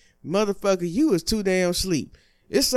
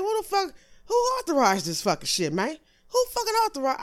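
comic outrage; 10 semitones higher than usual. Acted. Outraged rant about bad drivers.

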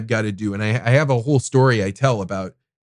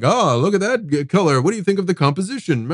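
pretentious art talk; 7 semitones higher than usual. Retold or imitated.

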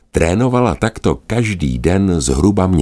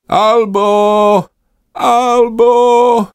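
high, loud call from afar; 5 dB louder, much slower than usual. Acted. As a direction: shout her name across the sky. Sister calls out to Alba. (vysoké hlasité volání sestry)

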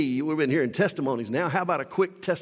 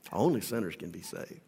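lower, softer echo of "Only sinners can be saved"; quiet repeat; 10 semitones lower, 8 dB quieter than usual. Acted. Preacher repeats the truth softly, low and quiet. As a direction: drop it to a soft, low echo.

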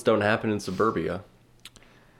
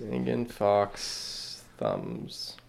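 very slow drawl; 8 dB quieter, much slower than usual. Pretend. Slow amused repeat.

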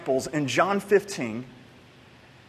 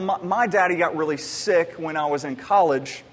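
normal talking voice

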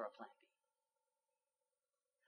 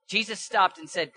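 ordinary delivery

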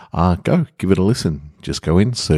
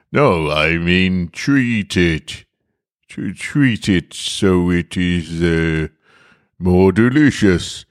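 slow, halting gruff disguise; slow gruff fumbling; 3 semitones higher, slower than usual. Acted. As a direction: drag it out like a man faking composure. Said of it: "He said in a gruff voice".

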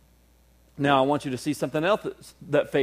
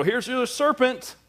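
mock offer; 8 semitones higher, slower than usual. Dad offering a serpent.

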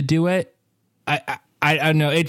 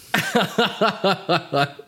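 laughing; 6 semitones higher than usual. Real, not acted. Laughing at a joke.

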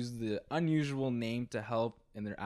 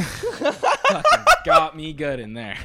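loud and slow through a laugh; 2 semitones higher, 12 dB louder, slower than usual. Pretend. Playing the wife after laughter yoga, laughing loudly. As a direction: laugh it out loud like a giddy patient.

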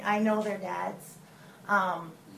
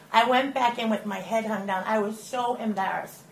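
ordinary talk

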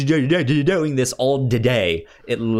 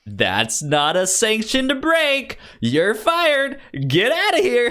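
much higher mock boss over the intercom; 12 semitones higher than usual. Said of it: "He's like".

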